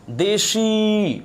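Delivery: দেশি drawn out very slowly, syllable by syllable; very slow demo. Shown as a demonstration. Teacher slowly sounding দেশি for the class. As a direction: stretch দেশি right out to the final ই. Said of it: "কিন্তু উচ্চারণ করবেন".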